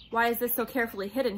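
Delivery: fast, loud snap; snapping. A narrator's reading. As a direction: fire the question angrily and quickly. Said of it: "he snapped".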